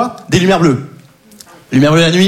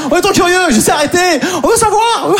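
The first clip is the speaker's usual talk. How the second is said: high, pleading crowd voice; mock eager audience; 10 semitones higher than usual. Comedian voicing the crowd begging for the anecdote, high mock pleading. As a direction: whine with exaggerated curiosity, way up high.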